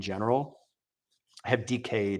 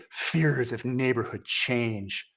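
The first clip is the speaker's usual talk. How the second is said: mock dramatic reading; 4 dB quieter, much slower than usual. Rereading the phrase in mock gravity.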